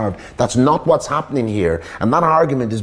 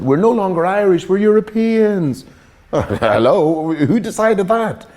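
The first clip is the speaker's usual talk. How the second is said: high, slow, incredulous scoff with a laugh; mock the idea, then demand who decided; scornful, pitched way up; mocking disbelief; 8 semitones higher, slower than usual. Real, not acted. Mocking the no-longer-Irish notion.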